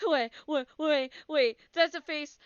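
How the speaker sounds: loud frantic repeated "wait"; panicked. Real, not acted. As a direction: blurt it out in rising panic. Panicked reaction to a face.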